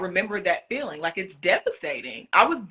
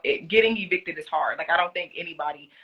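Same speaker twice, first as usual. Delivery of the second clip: ordinary talk, nothing marked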